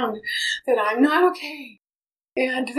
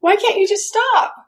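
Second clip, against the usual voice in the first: exasperated mother; 9 semitones higher than usual. Retold or imitated. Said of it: "a child who I was like".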